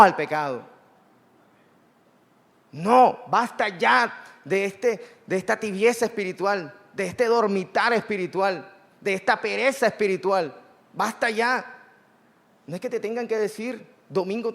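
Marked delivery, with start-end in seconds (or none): 2.7-11.6 slow, drawn-out opening no, then a forceful list; stirring the church out of its sleep, preaching with emphasis; emphatic preaching. Acted. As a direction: stretch out the first no, then hammer each basta. Rebuking spiritual lukewarmness.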